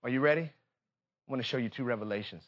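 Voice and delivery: ordinary delivery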